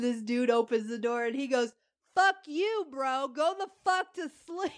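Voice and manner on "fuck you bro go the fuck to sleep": put-on drunk neighbor. Drunk neighbor cursing, laughing.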